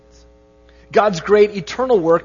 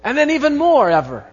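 proclaimed scripture; 3 semitones higher, 3 dB louder than usual. Real, not acted. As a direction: proclaim the doxology, swelling to the end. Reads doxology with rising force.

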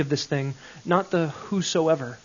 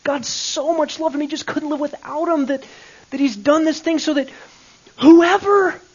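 high, rising, ever louder preacher voice; mock preacher; 10 semitones higher, 6 dB louder than usual. Mimicking the preachers he grew up hearing, high and loud. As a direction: ham up the old-style sermon, building to a big loud finish. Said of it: "we grew up hearing".

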